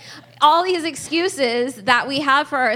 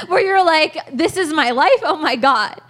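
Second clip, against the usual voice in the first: laughing, louder and higher; excited laughing; 4 semitones higher, 5 dB louder than usual. Real, not acted. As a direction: gush with tearful laughter. Overjoyed at her own life.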